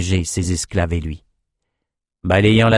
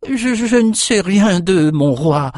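put-on high, slow, stuttering old servant; stammering; 10 semitones higher, slower than usual. A narrator's reading. Cook stammering to his king, frightened. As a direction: stammer out the denial. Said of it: "balbutia Emmett".

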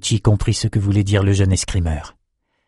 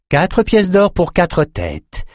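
slow, higher, Quatre pièces d'or spelled out; firm price; 9 semitones higher, slower than usual. A narrator's reading. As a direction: stress pièces, slow and falling. Maxime repeats the price.

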